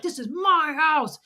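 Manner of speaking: high-pitched imitation of a man's rant; mock screaming. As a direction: retell his yell, high, staking a claim. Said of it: "he was screaming".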